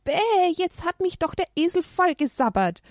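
put-on high disgusted child; high childish disgust; grossed-out boy. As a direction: go yuck at the drool.

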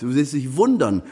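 ordinary talk, nothing marked

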